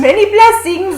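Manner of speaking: very high, loud parody farewell; over-the-top Renaissance send-off; mock fair blessing. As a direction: echo it shrilly in a put-on voice. Echoes mock sign-off higher.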